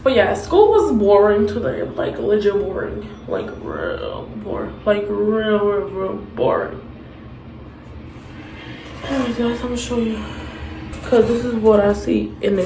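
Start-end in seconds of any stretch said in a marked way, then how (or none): 3.3-6.8 bored drawl. Pretend. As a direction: drag it out like school killed you. Bored teen drawling about school.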